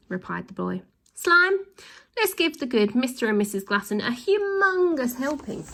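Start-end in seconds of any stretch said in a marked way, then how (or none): 1.1-5.7 high eager order; gleeful command. Acted. Boy orders slime to punish villains, high.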